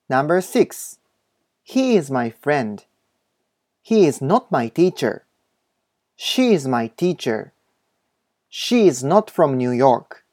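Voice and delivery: slow, carefully spaced model English reading; お手本の英文音読. Shown as a demonstration. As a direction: read each sentence slowly for learners to repeat. (英語のお手本をゆっくり音読)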